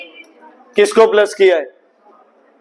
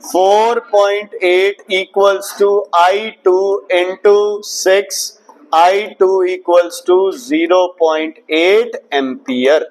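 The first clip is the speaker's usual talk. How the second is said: slow step-by-step calculation; slow working out; much slower than usual. Shown as a demonstration. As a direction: work it out slowly aloud. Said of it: "समझ आ गया?".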